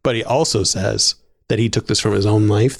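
normal talking voice

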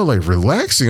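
normal talking voice

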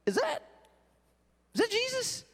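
high squeaky disbelieving "Is that Jesus?"; mock disciples wondering. Disciples squinting at the shore, high incredulous. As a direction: pitch way up, puzzled and incredulous. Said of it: "those guys would be like".

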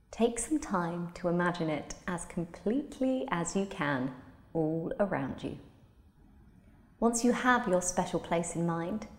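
usual speaking voice

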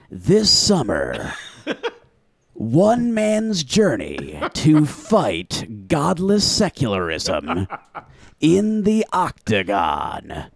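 slow, dramatic trailer narration; movie trailer voice. Acted. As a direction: announce it like an epic film trailer. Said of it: "the trailer voice synopsis of that?".

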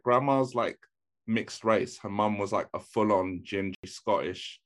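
ordinary delivery

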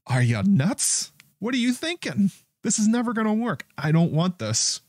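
shrill-free but very high 'are you nuts'; incredulous women. Retold or imitated. Host voicing the women rejecting the plan, high mocking disbelief.

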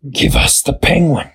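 sly spider demand. A narrator's reading. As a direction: say it like a hungry spider.

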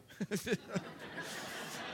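high wheezing burst of laughter; cracking up at the punchline; breathless laugh. Real, not acted. Laughs at the gas station story. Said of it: "busting out laughing".

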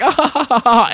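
high imitated cackling; mock laughter. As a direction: fake a high, spelled-out laugh. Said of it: "there's constant laughter".

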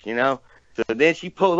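normal talking voice

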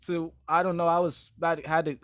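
ordinary talk

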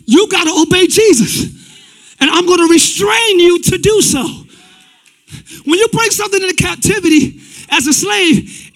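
loud, rising preacher calling to the crowd; full-force preaching. Acted. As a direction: preach it hard and loud to the congregation.

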